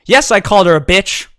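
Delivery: put-on defiant drunk. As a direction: blurt it out proudly and loud. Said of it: "And he says".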